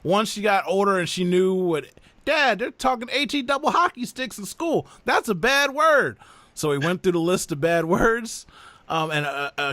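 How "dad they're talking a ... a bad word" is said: put-on high-pitched kid voice; imitated tattling child. Daughter reporting school swearing to dad, imitated high child voice. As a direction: play a scandalized little daughter, voice up high.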